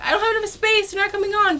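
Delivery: high-pitched mock panic; mock freaking out. Crowd complaining the band is late, mock freak-out. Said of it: "everyone was, like, freaking out".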